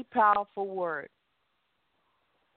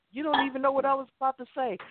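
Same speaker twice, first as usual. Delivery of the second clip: quick sassy retort; 7 semitones higher, much faster than usual. Retold or imitated. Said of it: "retold refusal".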